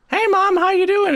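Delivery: high-pitched hello to Mom; acted daughter's greeting. Daughter going up to her mom, acted high greeting.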